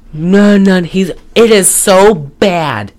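ordinary talk, nothing marked

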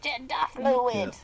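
put-on slow playful New Zealand accent. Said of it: "Pick up new vocal tics".

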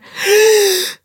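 sharp, high mock gasp; shocked gasp. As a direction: gasp loudly in mock horror. Mock reaction to illegal word.

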